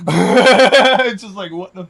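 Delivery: laughing in disbelief. Real, not acted. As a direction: laugh through the words.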